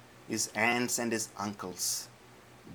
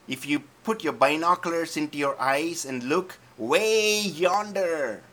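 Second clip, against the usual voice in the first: high, loud, mocking giant voice; swaggering giant mocking David; booming giant taunt; 9 semitones higher, 8 dB louder than usual. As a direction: bellow down at the little boy like a giant.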